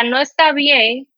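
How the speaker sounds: normal talking voice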